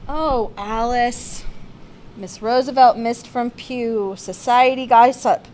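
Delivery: plain reading voice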